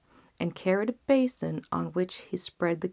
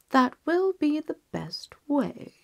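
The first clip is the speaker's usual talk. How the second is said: king; 9 semitones higher, 3 dB louder than usual. Acted. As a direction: weigh it aloud like a king. King decides.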